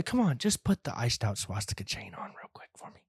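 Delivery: hushed sales pitch to a guest; conspiratorial whisper; whispered coaxing. Pretend. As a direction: whisper it, quiet and persuasive. Coaxing a guest to wear chain.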